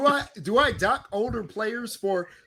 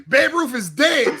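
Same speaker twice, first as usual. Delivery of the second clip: laughing; 6 semitones higher, 5 dB louder, much slower than usual. Real, not acted. Laughing at his own joke.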